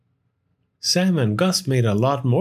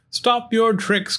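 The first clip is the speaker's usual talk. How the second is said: higher urgent call; 10 semitones higher than usual. Acted. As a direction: call it out firmly. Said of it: "called Sam".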